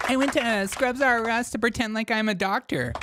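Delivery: high mocking protester voice; high, teasing put-on protester voice. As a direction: pitch it up as the silly fake doctor.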